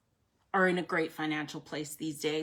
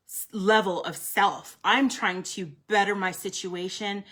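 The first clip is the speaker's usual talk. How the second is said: ordinary talk, nothing marked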